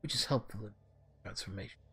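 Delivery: lightly voiced mentor, measured and uneven; wandering character voice. Algernon explains insect spirits to the orc, in a lightly voiced, wandering character voice. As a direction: explain like a learned character, the voice drifting slightly. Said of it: "My voice for him is just going all the way around".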